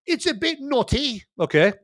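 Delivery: cheeky spy-comedy English impression; Austin Powers. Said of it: "like Austin Powers might interject".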